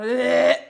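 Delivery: a sudden loud ugh of disgust; loud disgusted groan. Real, not acted. Comic gagging on Trump's name, a loud disgusted ugh. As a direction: let out a loud retching ugh. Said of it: "a visceral reaction".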